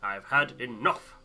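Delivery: a fed-up man exploding; furious rant. Acted. Zack rants at captain.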